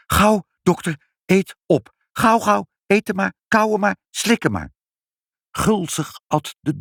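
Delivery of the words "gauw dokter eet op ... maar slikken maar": high-pitched, insistent commands; urgent urging. A narrator's reading. Mother makes the doctor eat hops, urgent. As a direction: hurry him along anxiously.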